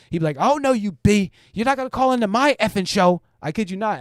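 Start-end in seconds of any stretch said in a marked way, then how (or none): 0.4-3.2 higher, louder mock tirade at a caller; mock angry cussing out. Streamer imitating the host cussing out a female caller, hammed-up angry. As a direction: rant at her like a furious host. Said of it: "cuss him out Taz style".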